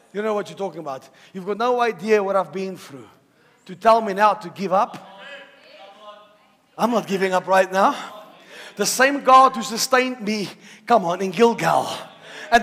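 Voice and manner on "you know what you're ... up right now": normal talking voice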